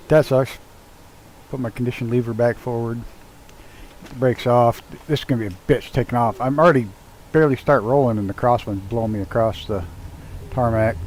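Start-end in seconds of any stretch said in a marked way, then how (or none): none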